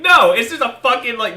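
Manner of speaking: usual speaking voice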